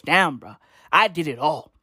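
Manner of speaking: low-pitched granddad looking back; reminiscing granddad. Acted. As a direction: drop low for a proud old man's recollection. Granddad speaking of his youth.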